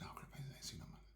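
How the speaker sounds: breathy hush of a whisper, voice gone; a retold whisper from a friend; whispered reply. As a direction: murmur it back like a secret.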